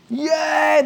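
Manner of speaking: high, drawn-out cheer; crowd cheer. Acted. Grandstand fans celebrating a home run, cheering. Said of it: "they holler".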